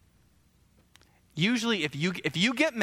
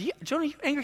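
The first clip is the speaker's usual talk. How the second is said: lightly voiced, higher-pitched questioning; a hint of a divine questioner; mock questioning God; 9 semitones higher, much faster than usual. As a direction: ask Jonah in a higher, teasing retold voice. God questioning Jonah in retelling.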